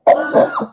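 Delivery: sharp cough. Real, not acted. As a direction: cough from gum down the wrong way. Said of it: "my little coughing fit".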